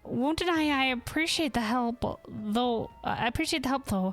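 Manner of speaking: composed searcher in a put-on voice; lightly British-leaning character, a little hesitant; Ayatoka, game character. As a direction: play a polite but wary quester.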